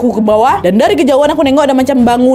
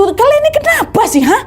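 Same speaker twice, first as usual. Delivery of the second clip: exasperated; 12 semitones higher than usual. Retold or imitated. Demanding why friends ran.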